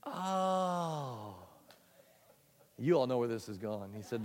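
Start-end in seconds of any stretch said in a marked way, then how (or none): 0.1-1.4 George realising. Acted. George realises his mistake.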